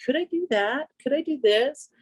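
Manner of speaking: playful retold wondering; curious experimenting. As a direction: try each idea out as a question. Voices own playful experimenting.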